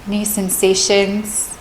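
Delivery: calm, measured meditation voice with long pauses; guided meditation. Real, not acted. Meditation teacher leading listeners through a body scan, calm and spacious.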